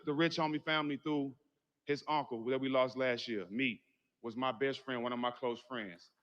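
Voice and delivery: normal talking voice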